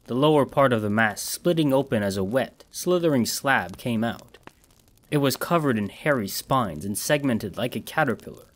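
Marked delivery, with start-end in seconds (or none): none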